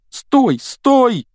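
high, loud repeated shouts; shouting. A narrator's reading. Ron orders the falling car to halt, shouting. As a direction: shout each word in panic, pitch soaring. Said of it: "кричал он".

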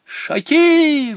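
high, loud call across the room. Acted. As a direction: yell the name up high. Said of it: "закричали все". (громкий высокий зов)

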